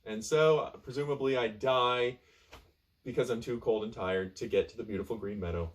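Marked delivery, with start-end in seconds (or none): none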